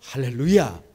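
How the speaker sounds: loud joyful hallelujah cry; shouted hallelujah. Real, not acted. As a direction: call it out to the whole church.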